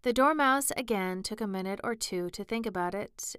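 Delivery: ordinary delivery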